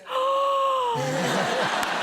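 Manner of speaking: big, high, drawn-out gasp of amazement; imitated child's gasp. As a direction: gasp loudly like an astonished little girl. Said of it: "And she goes".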